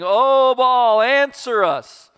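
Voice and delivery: high, loud pleading call; crying out to Baal. Acted. Preacher voicing Baal's prophets in a loud plea. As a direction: call out to Baal, stretching it slowly.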